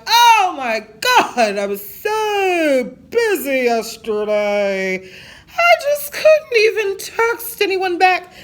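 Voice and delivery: high, slow, fake-crying drama voice; mocking people who make excuses for not texting back; mock whiny excuse. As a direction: whine it like a fake sob story. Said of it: "I don't be like".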